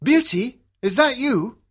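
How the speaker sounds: high, excited cry of a father; a father crying out at seeing his daughter; cries out. Acted. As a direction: call her name in joyful surprise. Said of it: "he cries".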